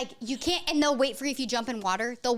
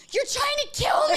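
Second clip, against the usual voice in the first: very high-pitched mock shriek; imitated screaming; 14 semitones higher, 4 dB louder than usual. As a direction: shriek like a frantic sleepwalking child. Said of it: "he starts screaming".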